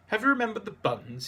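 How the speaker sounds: a hint of a fussy young Frenchman; high eager question; Antoine's voice. Antoine greets them at door.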